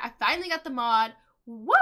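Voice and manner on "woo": short celebratory woo; excited whoop. Real, not acted. Celebrates finally getting the mod.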